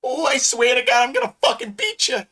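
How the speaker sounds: high-pitched comic threat; mock threat. Comedian swearing he'll beat someone, high mock threat.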